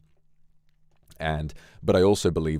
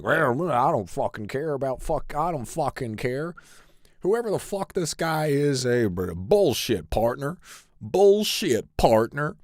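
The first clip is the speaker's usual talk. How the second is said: higher, loud dopey cowboy voice; imitating a dumb cowboy character, pitched up; idiot cowboy; 10 semitones higher, 4 dB louder, slower than usual. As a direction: play a brash cowboy who doesn't care about anything. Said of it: "what I want you to be is, like, a fucking idiot".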